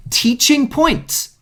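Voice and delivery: high, slow, sing-song teaching points; mock chirpy. Mocking herself with the class, high and slow. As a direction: brightly fake enthusiasm.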